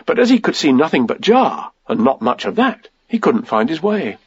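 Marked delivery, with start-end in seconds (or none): none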